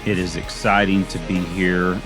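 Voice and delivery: ordinary talk, nothing marked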